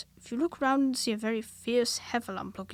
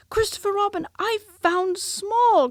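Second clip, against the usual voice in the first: much higher, louder proud cry; excited cry; 10 semitones higher, 9 dB louder than usual. A narrator's reading. Piglet to Christopher Robin, high loud proud cry. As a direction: call out proudly. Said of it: "cried Piglet".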